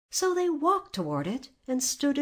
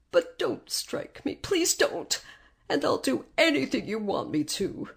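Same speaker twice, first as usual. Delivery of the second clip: higher, frightened little man begging; trembling plea; 5 semitones higher than usual. A narrator's reading. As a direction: plead with a touch of panic. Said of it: "said the man in a trembling voice".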